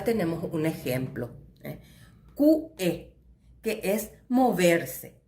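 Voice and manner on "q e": "Q E" as ku, short break, e; two separate beats in "Q E", split by a glottal stop; brief pause inside word. Shown as a demonstration. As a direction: cut the sound between "Q" and "E". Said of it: "hago una pequeña pausa".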